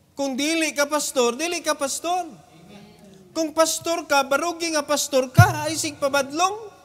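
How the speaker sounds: fiery preacher charging pastors to stand up; preaching at full force. Acted.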